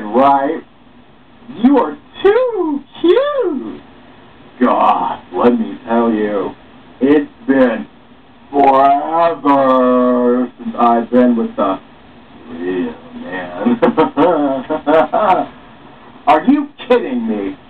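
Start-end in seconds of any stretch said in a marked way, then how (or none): 1.6-3.8 very high, slow, cooing; flirty gush. Acted. Woman cooing at Jared while flirting, high and slow. 8.5-13.8 slow flirty drawl. Acted. Woman drawing out her words at Jared, slow and flirty.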